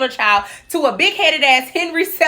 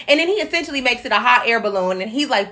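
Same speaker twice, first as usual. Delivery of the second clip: ordinary delivery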